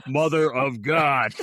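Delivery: slow, loud mock haunted voice; haunted corpse groom. Co-host imitating Phil in his corpse groom get-up, haunted voice. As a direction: boom it out like a spooky haunted-house speaker. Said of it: "he's speaking through that, um, that speaker that makes it sound, like, haunted".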